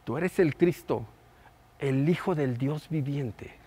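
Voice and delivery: slow it right down and let it sink in; slow soft repeat. Shown as a demonstration. Preacher repeating Peter's answer to the congregation, slow and hushed. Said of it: "¿Sí?".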